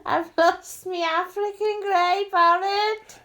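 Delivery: put-on high, loud sing-song Liverpool accent calling for the parrot; distraught owner calling her parrot, hammed-up Liverpudlian wail; mock Liverpudlian wail. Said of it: "you sound Irish, not Liverpudlian".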